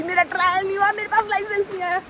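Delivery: high whiny lament over the license; high mock-sad wail; mock sad whine. Real, not acted. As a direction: pitch it up into a mock whimper. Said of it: "दुख हो रहा है मेरे को".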